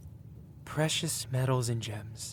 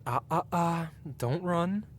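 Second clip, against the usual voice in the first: playful warning; 6 semitones higher than usual. Acted. Stops frightened listener from fleeing.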